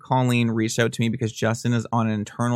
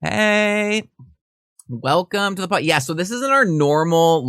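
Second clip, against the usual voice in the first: bright, high, louder welcome; host greeting; 7 semitones higher, 6 dB louder than usual. Acted. Podcast host welcoming listeners, high and upbeat.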